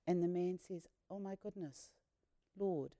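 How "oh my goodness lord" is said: awed hush. Acted. Man worships Jesus.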